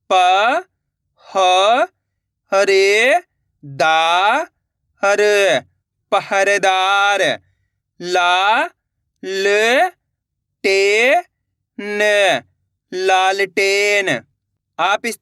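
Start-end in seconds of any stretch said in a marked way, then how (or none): 0.1-7.2 slow blended reading. Shown as a demonstration. Teacher showing pupils how to blend, said slowly. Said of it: "देखो किस तरीके से". 8.0-14.1 slow blended reading. Shown as a demonstration. Teacher showing pupils how to blend, said slowly. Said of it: "देखो किस तरीके से".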